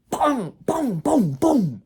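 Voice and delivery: loud, high "boom" hits; imitated punches. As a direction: punch out each boom like a blow landing. Imitates punches landing in beating.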